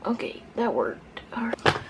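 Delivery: whispering. Real, not acted. Relieved aside.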